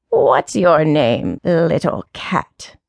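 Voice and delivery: put-on low, very slow taunt of an old cat; deep taunting voice. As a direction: circle her prey, slow and mocking. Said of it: "in its deep, taunting voice".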